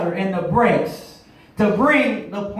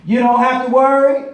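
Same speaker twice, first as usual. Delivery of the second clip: fiery sermon cadence over organ; preaching; 3 dB louder than usual. Acted. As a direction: preach it to the congregation.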